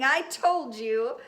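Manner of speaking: gloating. Acted. Gloating to parents.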